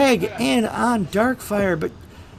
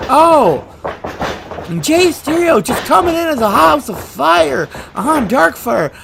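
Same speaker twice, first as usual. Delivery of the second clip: loud, higher hype call; excited shout; 6 semitones higher, 5 dB louder than usual. Acted. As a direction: roar it as he charges in. Partner storms into the ring.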